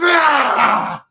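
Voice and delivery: short loud mock cry of pain; mock sudden scream. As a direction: blurt a sudden pained "Ah!". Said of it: "And it's".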